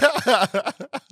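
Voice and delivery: high-pitched laughter; laughing. Real, not acted. Host amused by co-host's joking apology, high laugh. Co-host jokes about a bad experience.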